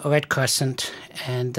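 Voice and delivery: ordinary talk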